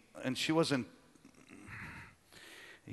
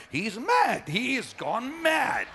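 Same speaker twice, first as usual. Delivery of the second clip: much higher and louder, slower at the end; alarmed mourners; 10 semitones higher, 3 dB louder, slower than usual. Retold or imitated. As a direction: cry it like a scandalized crowd. Crowd's reaction imitated.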